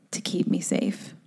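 breathy soft whisper. Real, not acted. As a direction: drop to a tender whisper. Soft, tender self-talk.

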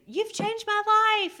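high, loud, slow gushing fan voice; podcast host mimicking a grateful follower's gushing comment, high and loud. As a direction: squeal it like a thrilled follower.

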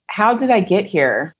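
ordinary talk, nothing marked

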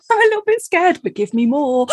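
high, playful mock fear; scared but eager, laughing; mock scared thrill. Host echoing the guest's thrill, high and teasing. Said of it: "I love that".

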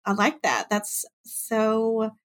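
normal talking voice

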